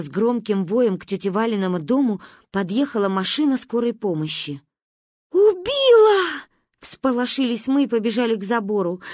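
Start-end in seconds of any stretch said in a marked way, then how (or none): 5.3-6.4 high, loud slow cry; horrified. A narrator's reading. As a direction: shriek in horror. Children panic at ambulance. (в ужасе)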